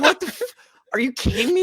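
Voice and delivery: exasperated player blurting in protest; incredulous outrage. Acted. Player reacting to having to use the bubble, incredulous outrage.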